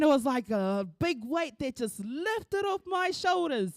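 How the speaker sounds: ordinary talk, nothing marked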